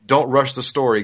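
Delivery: usual speaking voice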